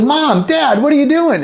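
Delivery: put-on high, loud cry of a startled kid; little Johnny shocked. Johnny walks in on his parents, high shocked yelp. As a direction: burst out in mock alarm, pitch shooting up. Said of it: "and he goes".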